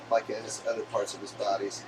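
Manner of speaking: ordinary talk, nothing marked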